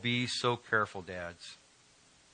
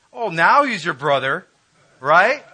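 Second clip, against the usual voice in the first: sarcastic; 5 semitones higher, 10 dB louder than usual. Real, not acted. Preacher mocks brothers' sudden loyalty.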